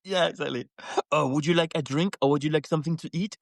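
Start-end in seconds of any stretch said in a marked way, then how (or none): 1.1-3.4 hammed-up French accent on English; put-on French accent, quickening; weird French accent. Man offering children a drink, mock French accent. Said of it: "putting on this weird French accent".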